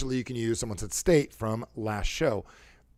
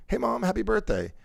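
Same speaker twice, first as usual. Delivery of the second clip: higher, tender birthday greeting; tone for Mom; 8 semitones higher than usual. Shown as a demonstration. Calling Mom on her birthday, sweet and high. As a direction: greet your mother sweetly, without pushing the volume. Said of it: "a different tone for different people".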